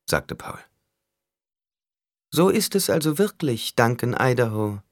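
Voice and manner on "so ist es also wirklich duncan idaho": musing. A narrator's reading. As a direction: muse aloud in a high character voice. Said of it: "sagte Skitale sinnend". (sinnend, mit hoher Stimme)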